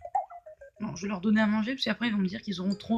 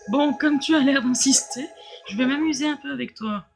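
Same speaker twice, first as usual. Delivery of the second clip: méchant théâtral, fort et moqueur; mustache twirling villain; 5 dB louder than usual. Acted. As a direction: gloat like a cartoon villain. Méchant du jeu, voix jouée. (méchant de dessin animé qui ricane)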